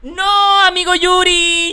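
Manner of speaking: grito agudo y alargado de sorpresa; saludo eufórico. Real, not acted. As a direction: recibe a tu amigo chillando de emoción. Saluda una raid de su amigo. (saludo a gritos)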